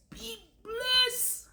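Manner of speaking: lilting high be blessed; sing-song blessing. Real, not acted. Signing off to her viewers with a lilting high blessing.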